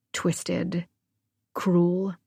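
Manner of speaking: breathy whispered word. A narrator's reading. Painful memory of her mother.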